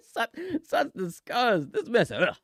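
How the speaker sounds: slow, high, theatrical disgust; hammed-up offended voice; mock disgust. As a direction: drag out each word in mock outrage. Mock outrage at a name.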